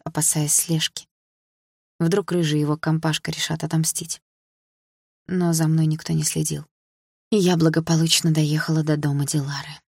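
ordinary delivery

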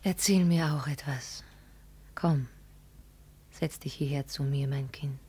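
soft whispered storytelling; hushed whispers. Acted. Girl whispers the emperor's tale to the daughter. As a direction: keep it secret and hushed. Whispered scene between two.

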